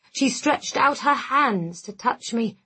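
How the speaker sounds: plain reading voice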